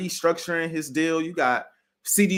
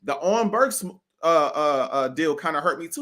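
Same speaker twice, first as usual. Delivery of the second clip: usual speaking voice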